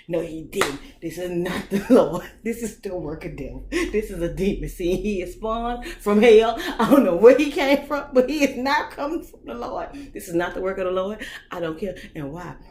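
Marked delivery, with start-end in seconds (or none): 0.1-11.3 loud, higher, building preacher rant; mock preaching. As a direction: preach it like a fired-up pastor. Preaching rant about the devil.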